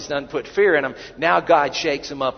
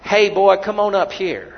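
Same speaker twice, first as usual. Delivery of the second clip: loud, higher Southern hollering taunt; 6 semitones higher, 7 dB louder than usual. Retold or imitated. Philistines hollering down at Jonathan, loud Southern taunt. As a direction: yell it down the cliff in a Southern accent. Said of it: "That's the Southern translation".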